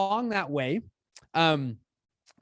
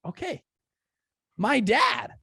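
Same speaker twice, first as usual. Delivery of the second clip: eager idea; 9 semitones higher, 3 dB louder, slower than usual. Retold or imitated. Idea strikes about dad.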